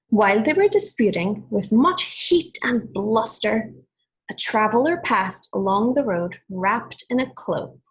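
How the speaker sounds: put-on clear, measured read-aloud for children; storybook reading voice; story narration. A narrator's reading. Teacher reads fable aloud.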